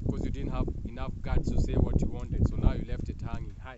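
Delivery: loud scolding rant; ranting loudly. Real, not acted. Speaker scolding men who text only hi, loud rant. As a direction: blast it at them like a scolding preacher.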